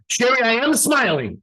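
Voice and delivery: high, strained protest of cheerfulness; forced smiling insistence. Retold or imitated. Trainee protesting to the coach who asked for smiles, high mock-cheerful insistence. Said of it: "this is how I'd be".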